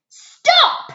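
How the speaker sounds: very high, loud furious shout; shrieked furious stop; angry shout. A narrator's reading. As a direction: bark it to halt them. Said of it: "shouted an angry voice".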